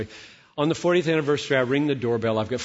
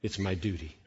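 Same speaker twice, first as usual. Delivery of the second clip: flat dutiful answer; 5 semitones lower, 7 dB quieter than usual. Acted. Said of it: "And I say".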